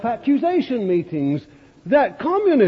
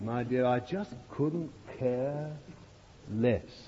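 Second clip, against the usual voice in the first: soft murmur; 8 semitones lower, 12 dB quieter, slower than usual. Retold or imitated. Pastor repeats his soft reply.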